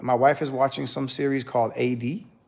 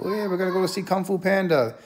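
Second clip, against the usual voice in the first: higher, bright-eyed kid voice imitating eager sons; excited kids; 6 semitones higher than usual. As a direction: voice the boys all eager and giddy. Kids excited about the movie.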